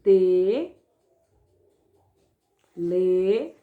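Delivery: Te- and le- said as separate loud syllables with long pauses; sounding out syllables. Shown as a demonstration. Teacher showing pupils how syllables join, loud and separated. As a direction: call out each syllable loudly, one at a time. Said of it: "olha aqui".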